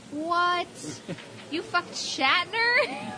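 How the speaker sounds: high-pitched incredulous question, drawn out slowly; retold disbelief. Granddaughter reacting to grandma's story, mock astonishment. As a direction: replay being stunned, pitch climbing.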